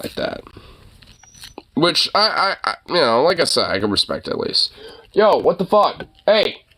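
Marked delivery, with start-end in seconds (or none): 5.1-6.6 raised, irritated scolding; higher-pitched annoyed call at a pet. Real, not acted. Owner to whining dog, scolding.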